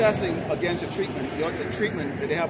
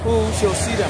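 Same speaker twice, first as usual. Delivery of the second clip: louder and higher scoffing question; mocking the wicked; 5 semitones higher, 6 dB louder than usual. Preacher mimics the wicked boasting, scoffing. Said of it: "They say".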